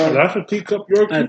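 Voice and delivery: high, loud surprised question; suddenly surprised and curious. Real, not acted. Surprised by the dog breed.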